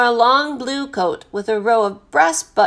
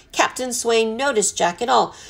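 ordinary delivery, nothing marked

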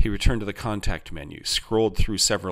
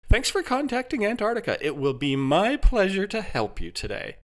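high-pitched customer-service pleasantness; pleasant, scripted helper in a high voice; 12 semitones higher than usual. A narrator's reading. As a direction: welcome the caller like an eager AI helper. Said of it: "Jarvis said pleasantly".

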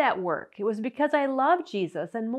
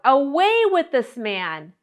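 loud crowd demand, much louder than the narration; crying out; 3 semitones higher, 9 dB louder than usual. Acted. Crowd demanding Pilate release Barabbas, crying out. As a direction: cry out as one angry crowd. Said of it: "with one voice they cried out".

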